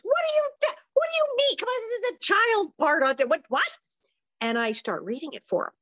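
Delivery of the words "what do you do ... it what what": high, squealing imitation of helpless laughter; mock hysterics, pitch shooting up; laughing father. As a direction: splutter it high, as if cracking up. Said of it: "my father laughs and says".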